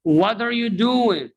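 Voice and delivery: "What are" as "wadder", high pitch; "doing" without G, "are" reduced to R; linked model phrase. Shown as a demonstration. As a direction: call out "wadder you doin'" for repeating. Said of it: "Repeat after me".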